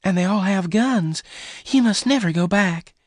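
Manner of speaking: high-pitched angry warning. A narrator's reading. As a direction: scold him high, forbidding it. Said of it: "She was angry".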